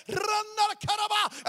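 high, loud shout in tongues. Retold or imitated. Preacher imagining leading family to Christ, loud ecstatic tongues. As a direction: cry it out like ecstatic prayer.

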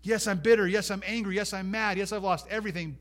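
high, insistent list of grievances, acted; defiant admission. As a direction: concede each hurt forcefully, as the character. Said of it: "she could probably echo with Job".

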